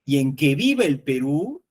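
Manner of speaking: "viva" pushed up and out, "que" unstressed; stress on viva. Shown as a demonstration. Teacher demonstrating emphasis to colleagues, stressing viva. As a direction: hit "viva", keep "que" small. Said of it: "no resalta más la cláusula que".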